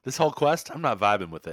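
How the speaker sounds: lightly voiced TV-show line, starting high and loud; mock show character. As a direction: parody the show's dialogue, punchy at first. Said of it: "sounds like something they would say".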